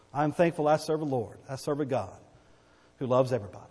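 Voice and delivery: ordinary delivery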